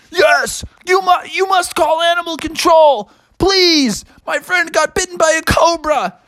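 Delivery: high, urgent storybook plea; frantic pleading. Acted. Bear begging driver to call animal control, frantic. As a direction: beg in a high, panicked voice.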